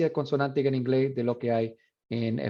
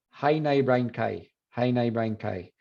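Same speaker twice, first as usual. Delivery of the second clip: imitated Irish ow turned to eye; Southern Irish vowels in how now brown cow; Southern Irish accent; slower than usual. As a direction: say cow as kai, Irish style. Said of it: "So that's another vowel difference".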